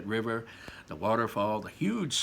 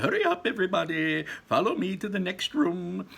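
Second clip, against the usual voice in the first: Wonka voice; 8 semitones higher, 5 dB louder than usual. A narrator's reading. Wonka calls his visitors to the boat, high and gleeful. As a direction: launch the trip with showman glee. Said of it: "said Mister Wonka".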